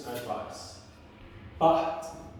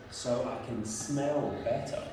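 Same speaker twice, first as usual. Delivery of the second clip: low, soft wolf-in-disguise voice; 5 semitones lower, 5 dB quieter than usual. Acted. Wolf explains himself to the child, low soft voice. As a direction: drop low and quiet for the wolf. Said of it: "And the wolf says".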